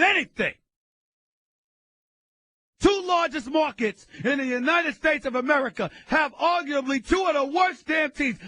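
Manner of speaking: loud, high, fired-up radio tirade; ranting shout. Real, not acted. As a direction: blast the teams at full volume. Host rants about worst teams.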